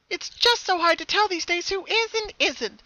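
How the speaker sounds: high, loud sing-song cheer; breezy and a little sly; cheerfully. Acted. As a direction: hidden pony teasing Pinkie, cheerful. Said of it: "the voice said cheerfully".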